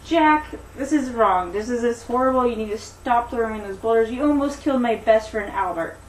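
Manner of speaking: understated angry scolding; mildly upset. Acted. Daisy confronting Jack over her best friend, mildly upset. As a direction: tell off the culprit, firm but not shouting. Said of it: "she is very upset by this".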